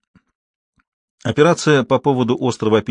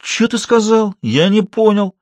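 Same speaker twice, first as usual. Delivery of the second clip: much higher, affronted thug; menacing challenge; 10 semitones higher, 4 dB louder, slower than usual. A narrator's reading. Bull-necked thug challenges the doctor, affronted and menacing. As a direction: challenge him as if spoiling for a fight.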